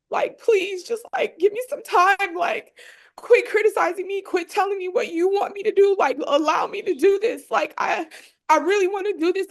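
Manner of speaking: high, cracking voice, close to sobbing; tearful, voice breaking. Real, not acted. Recalling the plea to her doubting dad, choking up with tears. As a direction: fight back tears on every word. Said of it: "it's making me emotional".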